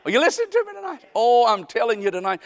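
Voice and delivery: ordinary delivery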